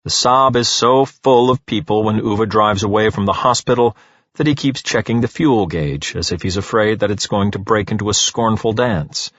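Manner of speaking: ordinary delivery, nothing marked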